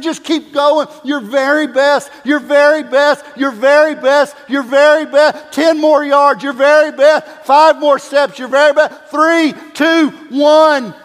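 loud, high coach yelling him home; coach shouting. Retold or imitated. Coach yelling the final yards to the exhausted player, loud and rising. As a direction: shout him over the line, building to the count. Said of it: "but he said".

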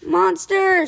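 high, loud alarmed shout. Acted. Boy spotting a creature, loud shout. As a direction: cry out the alarm. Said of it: "shouted Rip".

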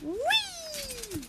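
high, drawn-out whee; gleeful whee. Pretend. Host throws ball for doggy, gleeful whee. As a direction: squeal a long playful "whee".

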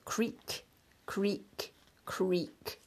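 soft, isolated creaks; creaking door. A narrator's reading. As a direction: draw out the suspense, hushed. Narrator imitates a creaking door.

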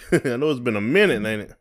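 normal talking voice

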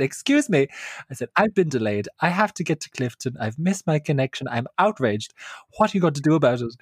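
high, indignant complaining; outraged complaint. Retold or imitated. Complaining to the ticket clerk, outraged. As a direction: protest like an offended customer. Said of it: "I went in very strong".

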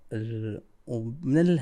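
ordinary delivery

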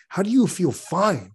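high, slow disbelief at "fine"; incredulous. Retold or imitated. Texting his calm partner, high incredulous disbelief. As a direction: pitch up in disbelief, drawn out.

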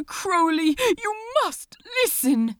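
high, loud, fast and desperate; urgent pleading. Acted. The angel begging Crowley to listen, high and frantic. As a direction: beg him to listen, near tears.

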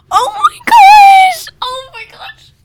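high screaming oh my gosh; excited shriek. Real, not acted. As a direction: shriek it with delight. Shrieking at shout-out idea.

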